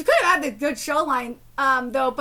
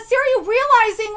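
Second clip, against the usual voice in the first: normal talking voice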